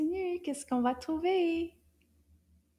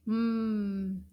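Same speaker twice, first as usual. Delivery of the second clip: ordinary talk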